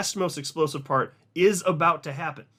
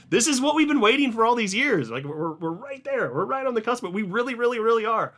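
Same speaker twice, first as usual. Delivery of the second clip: hyped up; 8 semitones higher, 3 dB louder than usual. Real, not acted. Crypto host telling viewers the rally is here, giddy and excited. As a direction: pitch jumps much higher, bursting with excitement, a laugh at the start. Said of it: "I don't normally talk like this".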